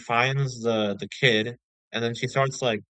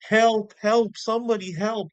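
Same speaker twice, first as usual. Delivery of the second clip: high pleading cry for help; imitating a desperate little girl; 11 semitones higher, 4 dB louder than usual. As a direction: mimic a scared girl calling out. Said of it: "He's like".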